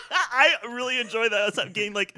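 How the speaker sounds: laughing. Real, not acted. Laughing through the line.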